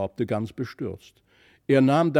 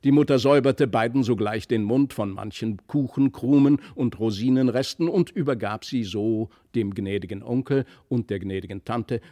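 ordinary delivery, nothing marked

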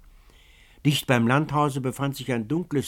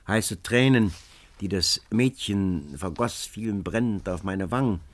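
usual speaking voice

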